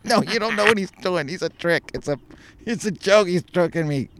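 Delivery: lightly voiced high boy protesting; boy trying to convince grandma it's a trick; protesting kid. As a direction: plead with Grandma that it's a joke. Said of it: "I'm like".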